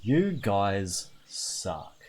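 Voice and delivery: very slow, low grumble; deflated resignation. Acted. Streamer gives in to chat's choice, deflated grumble. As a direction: draw out each word in defeat.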